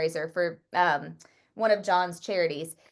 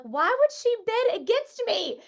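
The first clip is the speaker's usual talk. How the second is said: high, disbelieving indignation; retelling her own jealous fury at the bidder, indignant and high; mock outrage; 12 semitones higher than usual. Playful indignant rant. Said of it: "I was so mad".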